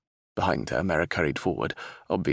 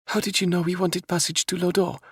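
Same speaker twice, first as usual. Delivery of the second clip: raised, youthful princess voice for Isabella; 13 semitones higher than usual. Acted. Princess questions the captain, anxious young voice.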